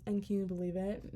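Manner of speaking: usual speaking voice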